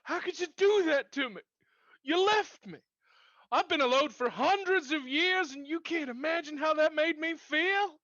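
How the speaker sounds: hurt, furious reproach; angry. Acted. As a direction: rage at the one who left you. Jilted prince confronts Taffy.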